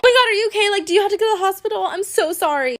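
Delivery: high, fast panicky apology; retold panicked driver, high and fast; mock hysterical. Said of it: "hysterical, freaking out like".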